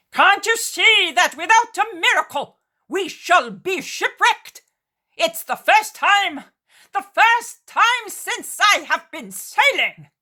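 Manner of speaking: high, loud furious yell, slow; furious shout. Acted. Cretan shouting furiously to drive them below. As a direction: roar it out at them, dragging the words.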